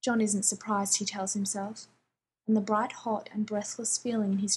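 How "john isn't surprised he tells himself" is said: ordinary delivery, nothing marked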